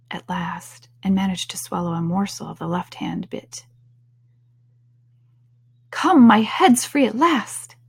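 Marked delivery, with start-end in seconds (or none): 5.9-7.8 higher, louder burst of delight; Alice, delighted. A narrator's reading. Alice freeing her head at last, delighted. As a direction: exclaim it with relieved delight. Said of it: "in a tone of delight".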